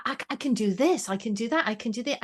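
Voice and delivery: normal talking voice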